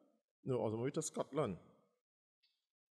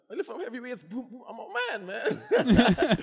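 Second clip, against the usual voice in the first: high, laughing boast; boasting about heavy weights while laughing; laughing brag; 11 semitones higher, 14 dB louder, slower than usual. Real, not acted.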